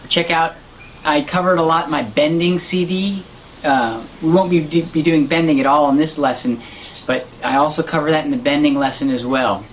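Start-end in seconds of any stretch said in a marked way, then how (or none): none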